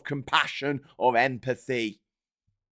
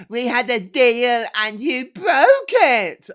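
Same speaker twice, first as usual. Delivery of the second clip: higher, louder, very slow wounded declaration; slow, loud, higher mock-wounded reading of a headline quote; mock dramatic quote; 10 semitones higher, 11 dB louder, much slower than usual. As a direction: ham up the betrayed politician.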